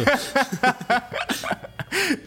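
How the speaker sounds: high-pitched burst of laughter. Real, not acted. Laughs at the bribe joke.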